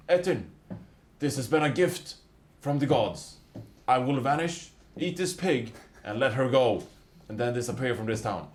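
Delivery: loud, high booming command; commanding yell. Acted. Cleric booms a command spell at the giant. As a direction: boom it out like a cleric's holy command. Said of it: "and I scream".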